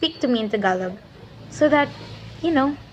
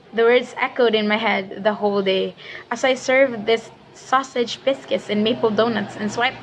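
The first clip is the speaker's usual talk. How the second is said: ordinary talk, nothing marked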